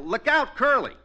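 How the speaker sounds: loud warning yell; yelled cue. Pretend. Partner rehearsing the fake accident, loud warning yell. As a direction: shout it out as a warning. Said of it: "I'll yell".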